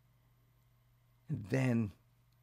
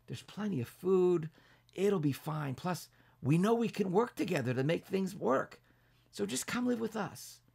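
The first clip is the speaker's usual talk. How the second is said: welcoming neighbors; 10 semitones higher than usual. A narrator's reading. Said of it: "Rezi and all of his neighbors said".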